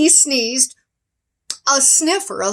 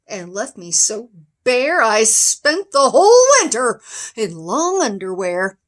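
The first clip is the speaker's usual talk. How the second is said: usual speaking voice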